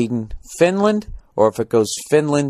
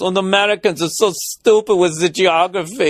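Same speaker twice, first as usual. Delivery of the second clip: higher-pitched put-on Danish accent; mock Danish accent; 7 semitones higher than usual. Danish cabbie needling American passenger, mock Danish accent. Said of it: "the cab driver turns around and goes".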